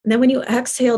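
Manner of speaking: normal talking voice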